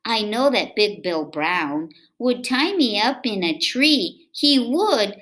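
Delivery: lively, sing-song read-aloud voice; reading to children. A narrator's reading. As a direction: read it to little kids with bounce. Reading picture book aloud.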